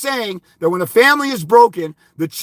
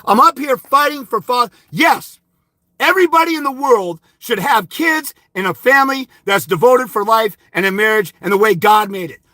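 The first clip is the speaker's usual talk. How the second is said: ordinary talk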